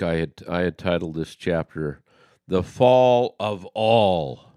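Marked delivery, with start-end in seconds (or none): 2.5-4.5 louder, slowly stretched FALL and ALL; emphatic title. Shown as a demonstration. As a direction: hit FALL and ALL hard, drawing each out. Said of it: "I wanted to be just really emphasize, exclamation mark".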